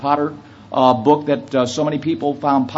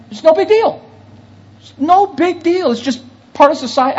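higher, lightly voiced shrug of a line; mock nonchalance; 12 semitones higher than usual. Preacher mocking the girl's shrug to the congregation, higher mock-casual voice. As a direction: toss it off like it means nothing, mocking her.

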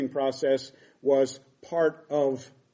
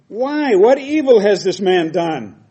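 much higher, louder, slow protest; indignant cry; 5 semitones higher, 10 dB louder, slower than usual. Acted. The governor challenging the crowd, loud and indignant. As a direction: demand it of the crowd, outraged.

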